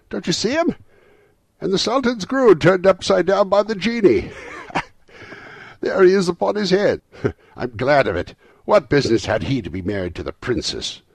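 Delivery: high, loud, breaking into laughter; ecstatic, laughing and crying. A narrator's reading. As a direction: shout it in giddy delight. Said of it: "extraordinary voice between laughing and crying".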